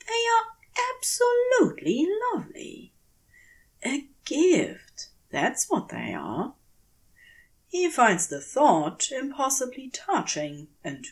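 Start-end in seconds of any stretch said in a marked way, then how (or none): 0.1-6.5 much higher, gushing over a lovely gift; a delighted Aziraphale holding the flowers; Aziraphale, enchanted. Acted. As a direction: rush the praise, then settle fondly. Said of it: "he says".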